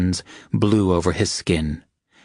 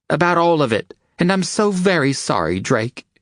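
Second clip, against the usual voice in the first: much higher, pained pleading confession; high voice tumbling through remorse; 11 semitones higher than usual. A narrator's reading. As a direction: pour out the apology, hurting. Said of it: "she said painfully".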